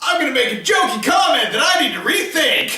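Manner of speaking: high, loud, laughing self-mockery; laughing shout. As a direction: blurt it out through laughter. Mocking own joke, laughing.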